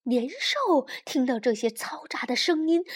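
plain reading voice

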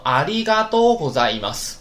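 ありがとうございます kept level, no tune; flat wrong reading. Retold or imitated. Teacher repeats the flat mistake to warn students. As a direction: read ありがとうございます monotone. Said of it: "不要读成".

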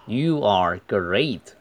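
slow model line with GREAT drawn out; long diphthong. Shown as a demonstration. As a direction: stretch the "ay" in GREAT fully. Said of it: "还是要把它读长". (GREAT 的双元音读长，慢速示范)

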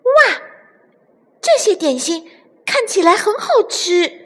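very high, wide-eyed wonder; little chick delighted by a surprise treat; amazed exclamation. A narrator's reading. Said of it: "惊奇地喊了起来".

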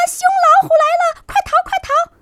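very high, fast frightened squeal; panicked animals. Acted. Small animals cry out as the tiger comes, high and panicked. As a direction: squeal in terror and run.

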